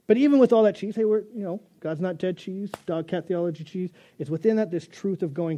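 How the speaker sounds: ordinary talk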